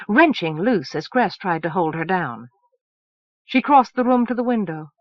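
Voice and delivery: ordinary delivery, nothing marked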